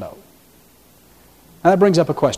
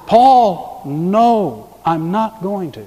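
emphatic no; 2 semitones higher, much slower than usual. Acted. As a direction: voice God's firm refusal, word by word. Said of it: "it was an emphatic no".

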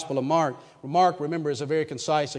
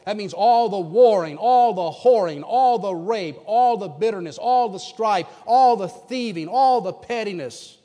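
louder, each all hammered; rising louder sermon list; preaching at full force; 4 semitones higher, 5 dB louder than usual. Acted. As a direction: pound every all. Building sermon list of sins.

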